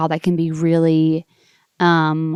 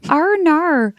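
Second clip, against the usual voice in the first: Australian "Arnar", the vowels long and open, pitched much higher; Australian accent; 10 semitones higher than usual. Shown as a demonstration. Showing off her Australian accent to the host: Australian "Arnar". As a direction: say "Arnar" with a bright Aussie lilt. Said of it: "I've been working on my Australian accent".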